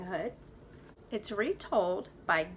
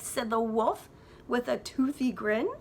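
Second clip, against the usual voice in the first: put-on lively read-aloud for kids; story narration; 4 semitones higher, 6 dB louder than usual. A narrator's reading. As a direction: tell it like a bedtime storybook. Teacher reads story aloud.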